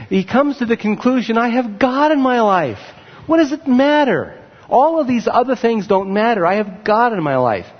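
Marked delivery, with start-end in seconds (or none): 1.4-7.7 higher-pitched voicing of a sudden conviction; acted-out breakthrough, pitched up then settling; emphatic realization. Preacher voicing Asaph's conclusion about God, emphatic realization.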